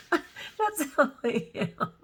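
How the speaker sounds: spoken through laughter, slow; laughing admission. Real, not acted. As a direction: giggle it out. Laughing at self.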